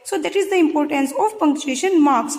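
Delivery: ordinary talk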